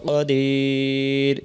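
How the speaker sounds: কাদির with the আ stretched very long; with the long pull. Shown as a demonstration. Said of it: "টান অবশ্যই দিতেই হবে". (কাদির, আ-এর টান লম্বা করে)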